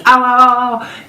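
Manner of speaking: high loud ows of pain; yelping ow ow ow at the doctor. Retold or imitated. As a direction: cry out as the scissors hit. Sudden pain during cutting.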